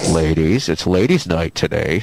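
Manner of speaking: very low, put-on host voice; hammed-up deep radio announcer; mock announcer. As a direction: drop way down to announce ladies' night. Mock host announcing ladies' night.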